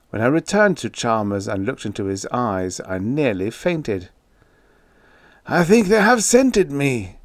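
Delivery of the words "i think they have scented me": high, loud cry of panic; frightened, raised-pitch outcry; terrified shriek. A narrator's reading. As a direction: shriek it, as if hunted. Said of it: "he shrieked".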